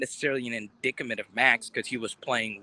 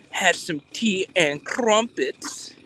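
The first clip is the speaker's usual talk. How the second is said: high, slow put-on British accent; mock British accent; 7 semitones higher, slower than usual. Vlogger showing off for companion before tea, mock British accent. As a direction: draw it out in a hammy British accent. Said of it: "Was that a good British accent?".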